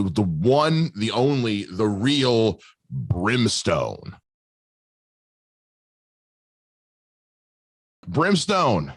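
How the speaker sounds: drawn-out hype ring announcer; announcer intro. Acted.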